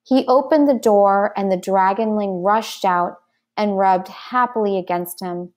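plain reading voice

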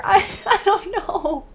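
high, slow laughing confusion; stammered, giggly bafflement; helpless laughing. Real, not acted. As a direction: laugh while admitting you're lost. Stuck in game, laughs helplessly.

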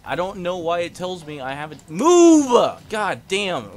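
rising to a loud yell; frustrated gamer shout; angry outburst. Real, not acted. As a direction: snap at the game. Yelling at stuck character.

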